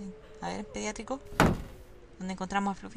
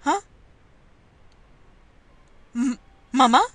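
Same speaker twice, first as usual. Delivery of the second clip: put-on frightened boy calling out, louder; scared boy; 10 semitones higher, 5 dB louder than usual. Boy finds someone in the morgue, scared call. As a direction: call into the dark, afraid.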